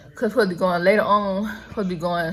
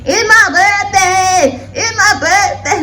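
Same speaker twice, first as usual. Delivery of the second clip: even higher, slower ratchet squeal; 10 semitones higher, 6 dB louder, much slower than usual. Retold or imitated. Vlogger exaggerating the ratchet birthday voice, higher still. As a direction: stretch every word out, sassy and loud. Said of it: "that ratchet voice".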